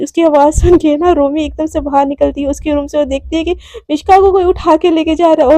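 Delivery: ordinary delivery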